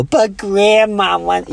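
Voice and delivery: put-on high, slow little old grandma voice. To the crying woman, teasing in a high grandma voice. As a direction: coax her back in a sweet old-lady voice. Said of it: "I'd do a voice".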